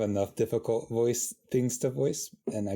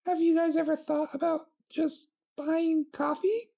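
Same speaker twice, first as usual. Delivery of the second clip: very high, puzzled pony princess; squeaky high and baffled; perplexed princess; 3 dB louder than usual. Acted. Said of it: "asked with a perplexed expression".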